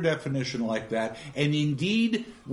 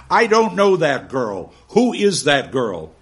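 stern demand; 8 dB louder than usual. Acted. Gradgrind demands the girl's name.

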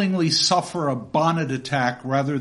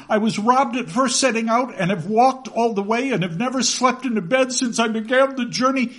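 put-on higher boy's voice pouring out his story; pleading boy; 8 semitones higher than usual. David begging his aunt to take him in, higher pleading. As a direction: plead breathlessly as the runaway child.

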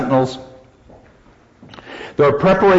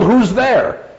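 mock yell; 9 semitones higher than usual. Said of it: "who yelled".

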